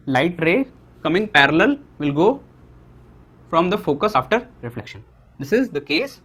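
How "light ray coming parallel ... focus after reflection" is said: drawn out word by word; slow teacher dictation of rule one. Shown as a demonstration. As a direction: dictate the rule slowly for notes. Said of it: "मैं धीरे धीरे बोल रहा हूँ".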